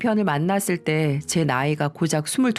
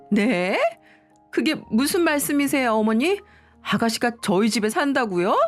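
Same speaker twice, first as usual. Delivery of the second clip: higher-pitched, incredulous questioning; acted shock at unwelcome news; shocked disbelief; 4 semitones higher than usual. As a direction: question it in disbelief, ending on sharp rises. Shocked that sister-in-law will move in.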